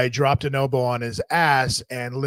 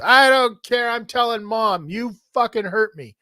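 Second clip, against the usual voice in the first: put-on whiny kid brother; 8 semitones higher than usual. Brother voiced in story.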